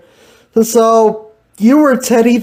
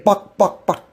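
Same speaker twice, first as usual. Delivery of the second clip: mock chicken clucks; 3 dB quieter than usual. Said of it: "Were you a chicken or something?".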